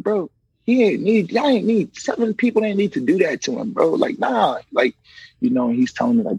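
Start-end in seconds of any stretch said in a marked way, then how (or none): 0.0-4.6 crying retold. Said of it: "I ended up crying".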